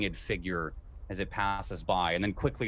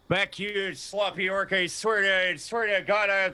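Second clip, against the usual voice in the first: frightened blustering guard; 9 semitones higher, 4 dB louder than usual. Acted. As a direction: bluster high, scared but brave. Guard threatens with sword.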